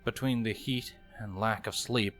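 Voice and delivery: ordinary delivery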